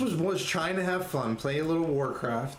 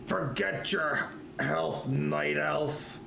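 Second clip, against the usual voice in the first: dismissive paladin; much slower than usual. Acted. Paladin brushes off the elf.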